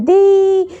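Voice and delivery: loud sudden call of di; a surprised shout from the doorway. A narrator's reading. Cousin calls out opening door. (ज़ोर से पुकारना)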